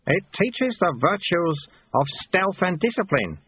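high-pitched instructing voice; old martial master; old sweeper Lutze. A narrator's reading.

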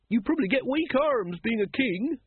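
high-pitched woman sharing her wisdom; wise-sounding woman. A narrator's reading. A woman explains kings' troubles to the crowd, wisely. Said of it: "said the woman wisely".

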